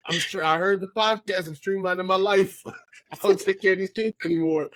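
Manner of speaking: amused, high-pitched mock announcement; mock careless parent. Jokingly voices a neglectful podcast listener.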